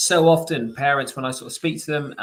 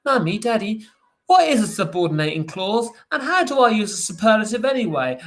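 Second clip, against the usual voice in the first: put-on higher, louder kid voice asking a tricky question; imitating a child; 5 semitones higher, 4 dB louder than usual. Child asks parent about grammar terms, high kid voice. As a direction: play the puzzled child wanting answers. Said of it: "turn around to you and say".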